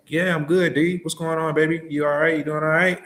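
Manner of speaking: high, loud, gushing friendly voice; friendly customer. Retold or imitated. Customer gushing back to the driver on the phone, high and loud.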